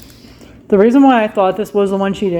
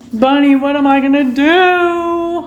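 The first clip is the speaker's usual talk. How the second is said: slow, higher, whiny plea to Bunny; mock whine; 7 semitones higher than usual. Asking Bunny for help with a hammed-up whine. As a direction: beg the pet for help, pouting. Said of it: "I am so sad".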